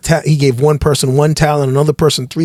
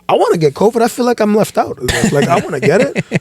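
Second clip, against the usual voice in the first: mock covid envy; 5 semitones higher than usual. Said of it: "And I'm like".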